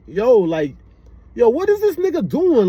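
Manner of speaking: high-pitched Jersey-style exclamation; Jersey accent. Shown as a demonstration. Vlogger sounding like his family from Jersey, Jersey accent. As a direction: pitch it up like Jersey relatives talking. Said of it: "sounding like my family member from".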